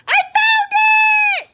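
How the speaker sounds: high, loud shout of discovery; yell. A narrator's reading. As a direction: yell it out in triumph. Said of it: "yelled Froggy".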